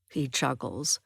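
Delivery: ordinary delivery, nothing marked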